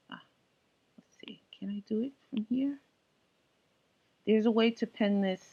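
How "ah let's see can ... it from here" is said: whispered aside. Real, not acted. Muttering to himself at screen.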